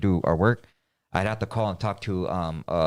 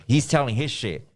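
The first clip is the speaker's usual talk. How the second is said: normal talking voice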